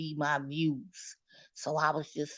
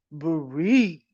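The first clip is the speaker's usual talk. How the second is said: machine command; 2 semitones lower, 5 dB louder than usual. Retold or imitated. Voicing the machine.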